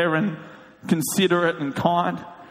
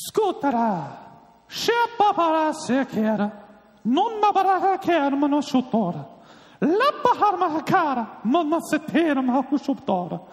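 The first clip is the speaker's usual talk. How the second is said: gibberish grace; 10 semitones higher than usual. Retold or imitated. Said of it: "it was something like this".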